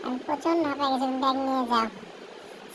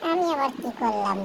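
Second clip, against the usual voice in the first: usual speaking voice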